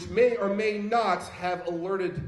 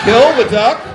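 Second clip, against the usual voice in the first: very loud, higher callback; incredulous shout; 4 semitones higher, 16 dB louder than usual. Real, not acted. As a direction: shout it over the crowd. Host calls back over crowd.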